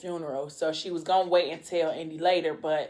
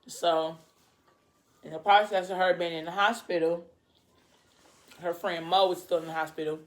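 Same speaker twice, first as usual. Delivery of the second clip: ordinary talk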